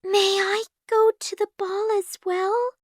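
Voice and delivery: high, timid little voice; small frightened plea; scared, hushed. A narrator's reading. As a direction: ask meekly, as if afraid to. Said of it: "asked in a scared whisper".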